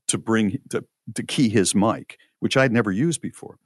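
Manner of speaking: usual speaking voice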